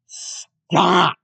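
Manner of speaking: loud barked stop; angry shout. Acted. Podcaster yells at denying cashier, loud. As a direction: cut her off with a shout.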